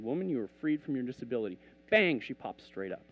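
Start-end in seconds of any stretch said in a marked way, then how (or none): 0.0-1.6 fast, matter-of-fact repeat; quick retelling. Retold or imitated. Preacher retelling his prayer over her, quick. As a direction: run it off briskly in one go. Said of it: "I looked at her and I said".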